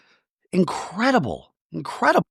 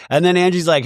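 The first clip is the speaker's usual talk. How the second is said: ordinary delivery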